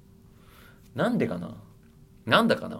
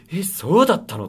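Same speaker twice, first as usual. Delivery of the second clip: mock surprise; 6 semitones higher, 6 dB louder than usual. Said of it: "とはなんないよ".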